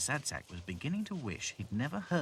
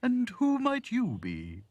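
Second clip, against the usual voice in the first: slow, high, loud giraffe voice; inquiring giraffe; 14 semitones higher, 7 dB louder, slower than usual. Acted. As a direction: draw the question out grandly. Giraffe questions dolls.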